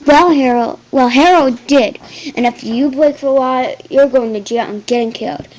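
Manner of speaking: loud blustering threat; general's hammed-up loud threat; threatening. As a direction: warn her loudly.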